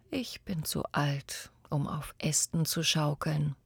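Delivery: soft, voiceless, worn out; an old man with no strength left; tired old whisper. Acted. Old boy tells the tree he is too old, whispering.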